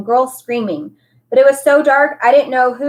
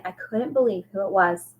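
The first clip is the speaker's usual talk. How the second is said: usual speaking voice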